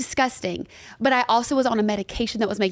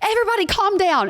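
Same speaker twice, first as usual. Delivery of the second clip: higher, stressed shout; stressed leader; 9 semitones higher, faster than usual. Retold or imitated. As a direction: tell everyone to calm down. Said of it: "he would get so stressed".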